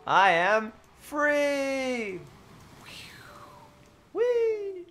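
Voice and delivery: slow, very high cry of glee; gleeful squeal. Pretend. Streamer plays at flying away for chat, gleeful squeal. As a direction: soar up into a long, high squeal.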